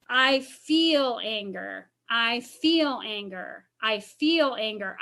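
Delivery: slow and gentle, stress on feel; slow, softening affirmation. Shown as a demonstration. Counselor modelling the next step, slow and gentle. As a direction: ease into it slowly. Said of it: "So now I say".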